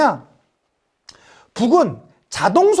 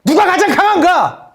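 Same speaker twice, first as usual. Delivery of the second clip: high, loud, drawn-out challenge; acted battlefield chant leader; commander's rallying shout; 6 semitones higher, 5 dB louder, slower than usual. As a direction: call out to the troops, demanding an answer. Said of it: "막 이렇게 외쳐요".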